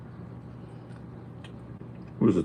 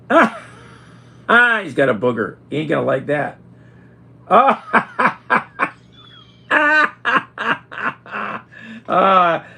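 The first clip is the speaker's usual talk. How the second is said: loud, high laughing shrieks; gleeful laughter; 12 semitones higher, 8 dB louder than usual. Real, not acted. Laughing at the cat after the mustard, loud and high. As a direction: cackle with delight at the prank.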